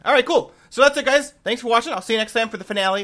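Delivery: loud, high, energetic sign-off; excited outro. Real, not acted. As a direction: pump up the goodbye.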